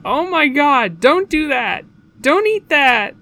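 high, loud panicked pleading; hammed-up distressed phone yelling; frantic screaming. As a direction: shout in horror at the caller. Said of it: "just screaming into the phone".

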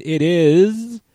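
loud drawn-out announcer call; big showman introduction; dramatic announcer build. Acted. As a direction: boom it out like a ring announcer. Host's dramatic introduction of co-host.

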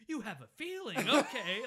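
high, loud, incredulous mock bellow; typical sergeant. General mocking his agent's hunch, yelling like a sergeant. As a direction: scoff loudly like a drill sergeant, laughing through it. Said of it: "Like a typical sergeant would say".

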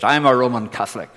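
loud, combative declaration; mock belligerent retold line. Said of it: "as belligerently as he could".

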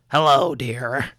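lightly voiced sweet old lady, slow; a hint of a fussy secretary greeting; warbling old secretary. Secretary greeting Lucia through the intercom, lightly warbling.

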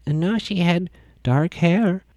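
a hint of a creepy kid, slowly; spooky child. Seth the boy to the liars, lightly voiced eerie child.

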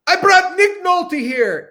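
high, slow, showy proclamation; grand announcement. Retold or imitated. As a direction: present him like a celebrity. Announcing him to the bar.

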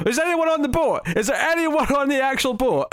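very high, sustained incredulous cry; mock exasperated disbelief. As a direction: build to a comic, high-pitched outcry. Mock exasperation at absent cast.

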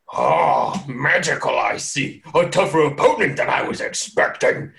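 intrigued villain sizing up a foe; impressed giant. Acted. Giant sizes up a magical opponent, intrigued creature voice. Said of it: "and is just like".

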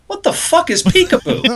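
high-pitched mock bewilderment; incredulous, squeaky-high retelling; baffled outburst. Coworker's baffled question, retold for laughs.

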